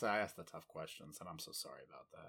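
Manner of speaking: very soft, low murmur; hushed, low mock-sorry; mock apology.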